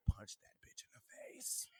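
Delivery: hushed joking whisper. Acted. Podcaster slipping his male listeners a joking secret, whispered. Advising men, comic aside.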